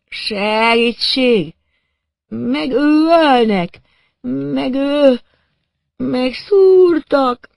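high, slow, drawn-out cries; wailing for help. A narrator's reading. Stabbed man calling for help from the bushes, wailing. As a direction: wail each word out loud in panic. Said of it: "jajgatva és segítségért kiáltozva".

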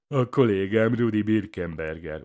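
psychopath's jeering echo; mocking imitation. A narrator's reading. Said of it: "utánozza a pszichopata".

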